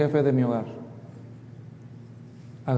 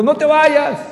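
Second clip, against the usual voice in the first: put-on very high, loud panicked cry of a child; father reenacting his son yelling as the door shuts; child's scream; 12 semitones higher, 8 dB louder, much slower than usual. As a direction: scream it like a terrified little boy. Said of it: "me gritó".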